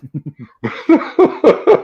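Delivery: a loud rising laugh. Real, not acted. Laughs at self-deprecating joke.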